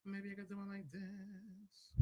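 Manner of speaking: drawn-out quiet test line; slow soft mic test. Shown as a demonstration. Testing the mouth camera for viewers, slow and soft. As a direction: say it slowly and softly. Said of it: "I didn't like it as a camera on my mouth".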